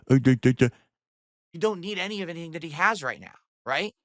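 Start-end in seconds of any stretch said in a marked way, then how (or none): 0.1-0.7 loud, lower, dopey mumble into the mic; mock a dim talker crowding the microphone; mocking mic-hugger. Streamer mocking how his rival talks, loud close-mic mimicry. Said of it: "talks like this into it".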